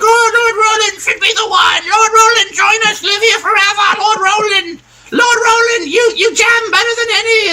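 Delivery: very high falsetto cheering; adoring crowd. Acted. Crowd cheering Lord Roland's performance, mock adoring shrieks. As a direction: shriek like devoted fans in mock adulation. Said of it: "there's some clapping in the crowd".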